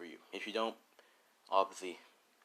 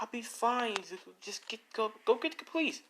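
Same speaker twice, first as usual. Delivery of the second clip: much higher, urging woman's voice; urgent woman; 13 semitones higher, 3 dB louder than usual. Acted. Woman urges Izuku to fetch police, high and urgent. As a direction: push him to run for help.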